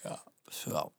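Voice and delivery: yawning through words; words stretched by a yawn; yawning sigh. Real, not acted. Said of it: "sorry I'm yawning so much".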